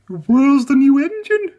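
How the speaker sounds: high, loud honk of a vintage lorry; honking Elizabeth. A narrator's reading. Elizabeth the lorry honks at Emily, high and loud. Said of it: "honked Elizabeth".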